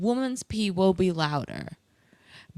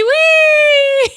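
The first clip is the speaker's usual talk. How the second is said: "oui" squeaked much higher and louder; high, loud comic "oui"; punchline oui; 13 dB louder than usual. Pretend. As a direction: pop the "oui" way up high as the joke. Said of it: "I just went like this".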